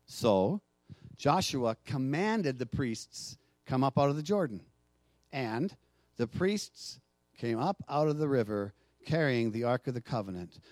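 soft, low reading of the passage over music; quiet Bible reading to close the sermon; hushed scripture reading. Acted. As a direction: read it gently, drawing them in.

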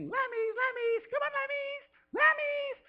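very high, drawn-out sing-song lamb call. Retold or imitated. Wife calling lambs home for the bottle, falsetto sing-song. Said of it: "I can't do it like you".

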